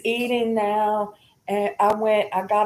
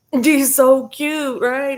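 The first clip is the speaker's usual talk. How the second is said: cooing; 5 semitones higher, 5 dB louder, slower than usual. Real, not acted. Cooing over kitten.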